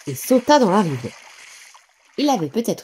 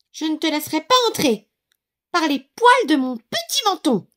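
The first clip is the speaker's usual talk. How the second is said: much higher, quick piggy voice; curt little pig; 10 semitones higher than usual. A narrator's reading. The pig refusing the wolf at the door, high and curt. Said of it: "dit sèchement le cochon".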